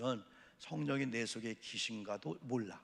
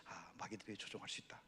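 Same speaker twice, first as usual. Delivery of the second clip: softer hushed whisper; 4 semitones lower, 13 dB quieter than usual. Acted. Pastor warning of demons, hushed whisper.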